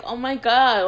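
loud, high shocked gasp of words; stunned outburst at hurtful words; shocked oh my God. Retold or imitated. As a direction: blurt it out in disbelief. Reacting to being called ugly.

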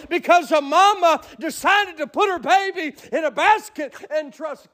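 high, impassioned sermon climax; fervent preaching. Acted. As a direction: preach the triumph to the congregation. Preaching climax at full force.

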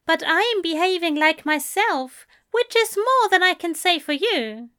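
high-pitched, huffy angel voice; high, fussy retort; prim angel, defensive. Acted. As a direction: argue back in a high voice.

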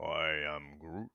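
slow, low creature voice; Groot impression. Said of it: "You basically get what".

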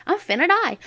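high-pitched mock terror; mock panic. On the rattling ride, a high mock panic. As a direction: squeal you're about to die. Scared on wooden roller coaster.

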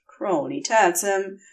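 ordinary delivery, nothing marked

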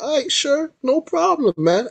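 high-pitched imitation of an agreeable man; easygoing agreement. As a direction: go way up in pitch for the quoted reply. Retold man agreeing to be photographed.

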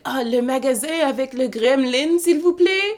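high, halting tourist French, rising into a plea; clumsy French. Retold or imitated. Lost in Montreal, she asks strangers in high, clumsy French. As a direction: ask in shaky schoolbook French. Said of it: "my French is".

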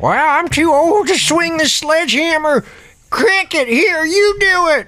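put-on high-pitched old-timer voice; old eccentric Ernie. Old Ernie passing Cricket the sledgehammer, high and cranky. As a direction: hand off the job in a high character voice.

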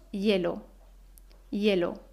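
"hielo" dicho "yelo"; H como Y. Shown as a demonstration. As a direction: empieza "hielo" con una ye clara. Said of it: "En realidad decimos". ("hielo" pronunciado "yelo")

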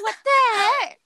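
high, loud shocked cry. Retold or imitated. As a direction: recoil in disbelief. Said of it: "it scared me so much".

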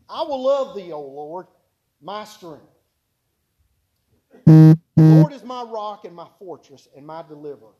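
loud, declaiming reading of a psalm; fervent scripture reading. Acted. Preacher reading Psalm eighteen to the congregation, proclaiming. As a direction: proclaim the psalm with full force.

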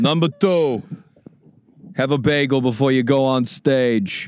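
put-on Brooklyn accent; Brooklyn guy. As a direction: hand down a rule as a Brooklyn guy. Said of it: "I'm a Brooklyn guy".

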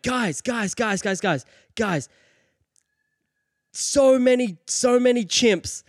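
high, urgent yell, hammed-up alarm; panicked mock warning. He runs back to warn the crowd, mock-panicked high cry.

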